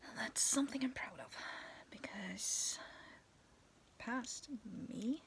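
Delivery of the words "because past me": usual speaking voice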